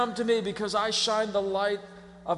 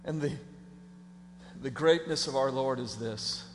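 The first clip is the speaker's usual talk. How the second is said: ordinary talk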